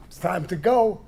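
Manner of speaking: loud, firm order to the deer; firm command. Retold or imitated. Telling the deer to leave, a loud firm order.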